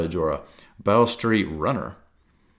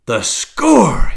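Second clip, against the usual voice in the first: loud, high sudden outburst; bursting out; 9 semitones higher, 11 dB louder than usual. A narrator's reading. Silver remembering his unpaid score, bursting out. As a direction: blurt it out suddenly. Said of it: "he burst out".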